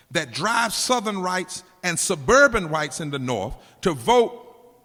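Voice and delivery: loud, rising preacher's cry; preaching at full force. Acted. Preacher thundering the strategist's words at the congregation. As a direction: drive the quote home from the pulpit.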